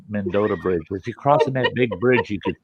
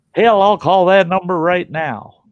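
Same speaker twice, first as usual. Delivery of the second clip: loud, high, slow eager yes; loud, slow, over-eager reply; 9 semitones higher, 7 dB louder than usual. Retold or imitated. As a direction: jump at the offer out loud.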